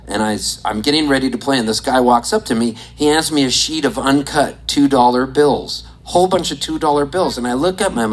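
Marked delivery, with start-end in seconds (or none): none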